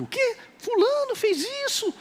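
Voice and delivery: mock shock. Someone scrolling Facebook, shocked by gossip, squealing high. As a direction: act scandalized at the news.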